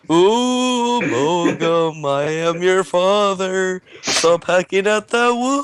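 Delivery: high, slow ghostly moan; spooky ghost voice. Acted. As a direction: wail it out like a ghost. Ghost posing as father.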